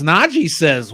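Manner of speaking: usual speaking voice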